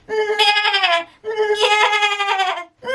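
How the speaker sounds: high, tight, piercing twang; twangy meh. Shown as a demonstration. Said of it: "Twang is very piercing, loud and high and tight".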